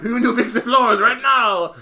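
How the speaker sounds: loud, high cartoon French accent; hammed-up French outburst; mock French accent. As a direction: shout it like a comic Frenchman. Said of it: "enough with the French".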